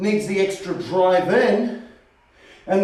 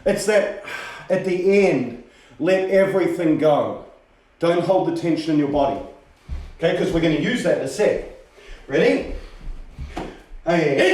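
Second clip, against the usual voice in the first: ordinary delivery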